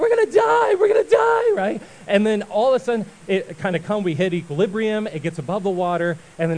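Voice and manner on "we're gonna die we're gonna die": hammed-up high, loud shriek; panicked screaming. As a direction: scream like a whole boat of terrified passengers. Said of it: "after a few minutes of all this screaming".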